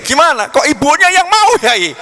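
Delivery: high, loud dismayed asking; panicked question. Retold or imitated. Young man panicking to his teacher, high and loud. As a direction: squeal in disbelief.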